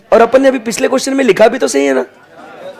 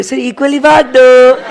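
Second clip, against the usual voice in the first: cute student voice; 5 semitones higher than usual. Retold or imitated. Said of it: "कैसे cutely बोल रहे".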